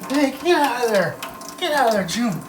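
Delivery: high, loud panicked yells; frantic shouting. Acted. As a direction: shout to clear the way. Shouted commotion, another voice.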